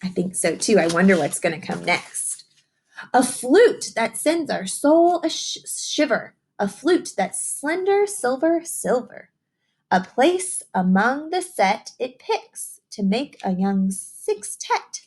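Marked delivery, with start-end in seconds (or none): 3.1-15.0 slower, higher storybook read with a lilt; picture book reading. Acted. Teacher reading a rhyming book to young children, sing-song storytelling. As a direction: read the rhyme to the children, savouring each line.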